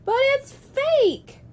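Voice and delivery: put-on high little-kid whine; whiny kid protest. A narrator's reading. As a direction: drag it out like a doubtful kid. Child objecting.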